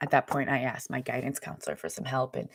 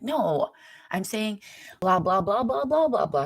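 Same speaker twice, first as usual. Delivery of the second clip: much higher, louder insistent blah blah blah; insistent repeat; 7 semitones higher, 4 dB louder than usual. Retold or imitated. Brother insisting to her, higher and louder babble. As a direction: hammer the babble home, pitch climbing. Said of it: "He's like".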